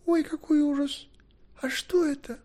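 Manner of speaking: put-on very high girlish alarm; high-pitched aghast girl; horrified cry. A narrator's reading. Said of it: "закричала Кередвен".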